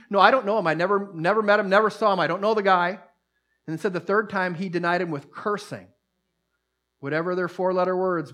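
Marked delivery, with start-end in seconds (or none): 0.1-3.0 Peter's denial. Retold or imitated. Peter denies knowing Jesus.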